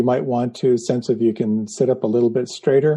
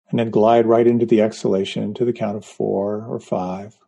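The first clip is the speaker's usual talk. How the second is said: guided meditation. Acted. Leads a guided breathing meditation.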